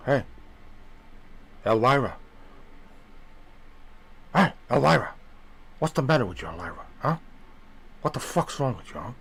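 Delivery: lightly voiced movie gangster; hint of a gangster needling someone; Tony Montana. Gangster calling out his Elvira, light impression. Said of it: "like, uh, Tony Montana".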